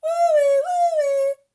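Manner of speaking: very high, loud wailing siren woo-wee; siren imitation. A narrator's reading. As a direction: wail it like a fire siren. Siren sound in story.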